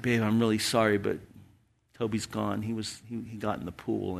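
soft, subdued retold apology; gently breaking bad news. Husband telling his wife the puppy is gone, soft and subdued.

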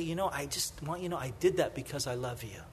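soft, low and quick; tender, hushed father. Retold or imitated. Father holding his son after discipline, speaking softly and tenderly. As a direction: hush it like a dad holding his child. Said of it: "He would say".

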